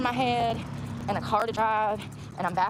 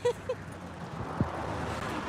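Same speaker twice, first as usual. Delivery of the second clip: breathy giggle; 11 semitones higher, 7 dB quieter than usual. Real, not acted. Laughing at own bit.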